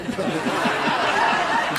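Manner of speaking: risa soplada; ríete de tu chiste. Real, not acted. (riéndose de su chiste)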